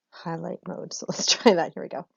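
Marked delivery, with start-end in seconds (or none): none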